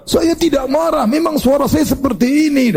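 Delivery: high-pitched, indignant protest, acted; mock angry denial. Someone scolded for temper answers back, mock angry and high. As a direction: imitate someone angrily insisting they aren't angry. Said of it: "itu namanya marah".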